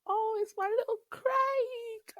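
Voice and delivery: very high, slow doting coo. Retold or imitated. Praising her friend's monologue in a very high doting coo. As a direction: coo it like a proud mum. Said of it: "I was just like".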